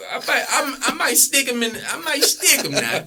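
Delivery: giggling while talking big; laughing at the thought of fighting him. Real, not acted. Laughing at fighting Chris Webber.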